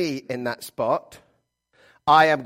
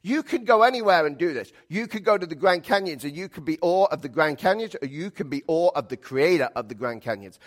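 ordinary delivery

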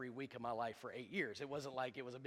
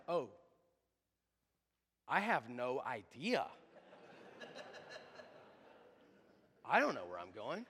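suddenly serious; 3 semitones lower than usual. Retold or imitated. Brent turning to his dad with no idea, mock serious. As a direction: imitate a stumped little boy, dropping low. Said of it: "all of a sudden it was all serious".

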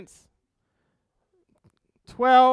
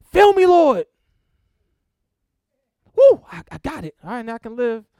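mock quick prayer; 8 dB louder, faster than usual. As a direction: parody a believer who wants instant filling. Mocking a quick, shallow prayer.

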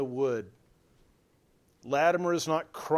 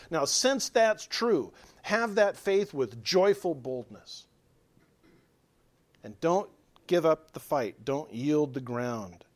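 fervent preaching; 3 semitones higher, 4 dB louder than usual. Acted. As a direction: drive the call home to the congregation. Climactic preaching appeal.

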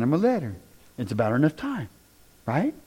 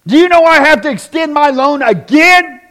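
loud, higher, exasperated demand; angry complaint; 10 semitones higher, 11 dB louder than usual. Retold or imitated. Angry homeowner yells at the project manager. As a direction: snap it like an outraged customer. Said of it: "instead of calling and going".

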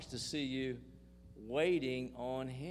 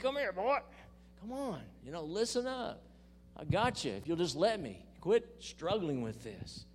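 put-on higher, coaxing voice of God calling a boy; lightly voiced fatherly God; 5 semitones higher than usual. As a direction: call him in gently but firmly. Preacher voicing God calling him.

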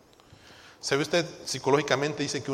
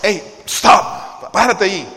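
alto repentino y fuerte; orden tajante; 3 semitones higher, 10 dB louder, much slower than usual. Acted. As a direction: frena los pensamientos de golpe, a todo volumen. Commanding distracting thoughts to stop. (grito de alto, firme)

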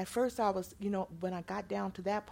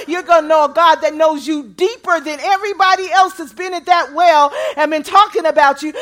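loud, high, rising sermon delivery; full-voiced revival preaching; preaching at full force; 7 semitones higher, 16 dB louder than usual. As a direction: preach it out to the whole room, building.

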